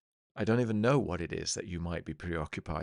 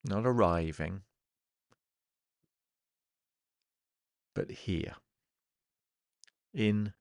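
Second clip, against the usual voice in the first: guided meditation. Acted. Hypnotherapist settling listeners into the present moment, guided meditation. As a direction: ease them into the present, phrase by phrase, leaving long silences.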